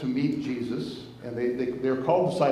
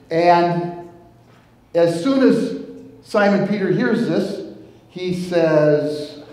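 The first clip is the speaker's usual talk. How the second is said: louder and higher, storytelling climax; rising excitement; building excitement; 4 semitones higher, 10 dB louder, slower than usual. Acted. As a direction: lift the story up loudly. Building to Peter's confession.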